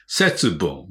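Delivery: put-on 「節分」 with a stressed, foreign-sounding accent; foreigner's accent. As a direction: say 「節分」 the way a foreigner would, adding stress. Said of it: "どうしてもアクセントをつけてしまうんですね".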